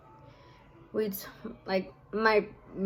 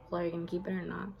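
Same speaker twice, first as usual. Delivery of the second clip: softer, serious retold question; really serious; 8 dB quieter, much faster than usual. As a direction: imitate a brother turning serious, voice dropped. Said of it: "he got really serious".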